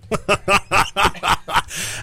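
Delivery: amused laugh at the caller. Real, not acted. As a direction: laugh at the wrong guess. Laughs at wrong answer.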